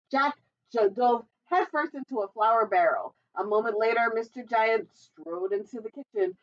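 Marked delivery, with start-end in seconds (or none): none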